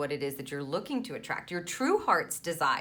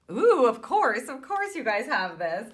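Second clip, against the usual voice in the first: delighted ooh; 6 semitones higher, 6 dB louder than usual. Real, not acted. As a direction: relish the discovery. Reader reacts to the cards.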